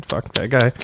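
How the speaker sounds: ordinary delivery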